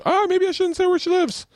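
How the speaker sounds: high-pitched sudden alarm; alarmed self-correction. Real, not acted. Host realizing he overshared about a woman, high alarm. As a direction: yelp it as you catch your own slip. Catches himself oversharing, cries out.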